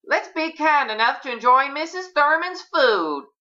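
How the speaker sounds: high, put-on aunt voice; aunt addressing the table. A narrator's reading. Aunt urges guests to eat, in high announcing voice. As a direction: call the table to order.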